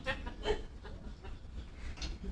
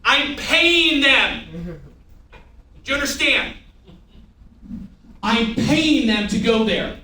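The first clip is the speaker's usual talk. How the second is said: higher, slow and insistent, leaning on "paying"; raised voice with a long, punched "paying"; emphatic off-mic; 4 semitones higher, slower than usual. Real, not acted. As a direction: stretch "paying" out hard to drive it home. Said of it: "I'm gonna do this off mic".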